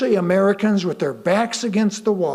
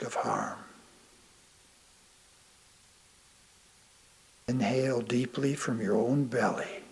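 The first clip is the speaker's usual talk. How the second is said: guided meditation; 5 semitones lower, 7 dB quieter than usual. Acted. Guiding listeners through a calming body scan, soft meditation voice. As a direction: ease the listener into calm.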